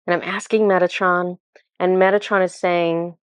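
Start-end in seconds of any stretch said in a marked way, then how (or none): none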